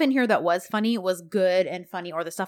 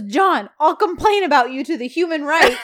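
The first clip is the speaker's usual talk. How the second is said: loud, higher-pitched complaint; mock indignant threat; 6 semitones higher, 7 dB louder than usual. Carried woman threatening to report him, loud mock indignation. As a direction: threaten him in hammed-up outrage.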